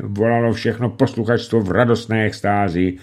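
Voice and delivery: usual speaking voice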